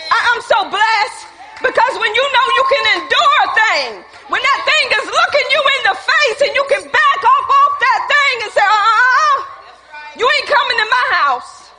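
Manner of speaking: high, loud, rising preacher's fervour; full-force preaching. Real, not acted. Preacher declaring victory over temptation, full-force preaching. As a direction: build to a loud, defiant refusal.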